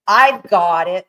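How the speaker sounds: slow, punched-out imagined dog thought; dog's triumphant realization. Acted. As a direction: voice the dog getting it, loud and drawn out. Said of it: "of the dog just going".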